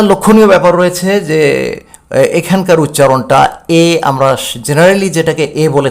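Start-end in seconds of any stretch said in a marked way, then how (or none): none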